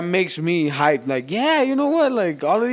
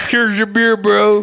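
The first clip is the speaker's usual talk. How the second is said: louder teasing quote through laughter; laughing mock quote; 3 semitones higher, 5 dB louder, slower than usual. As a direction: tease him while laughing. Mocking friend's bottle trick.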